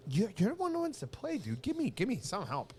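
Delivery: high, strained pleading retold; mock exasperation. Telling a friend to help choose songs, high mock exasperation. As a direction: plead upward, exasperated, as if re-enacting it.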